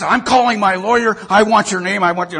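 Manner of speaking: high, loud indignant threat; loud high furious demand; angry threat. Retold or imitated. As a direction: bark it like a furious diva. Angry diva threatens reporter.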